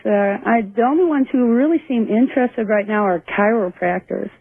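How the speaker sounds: ordinary delivery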